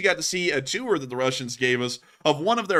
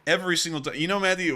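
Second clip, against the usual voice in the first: ordinary talk, nothing marked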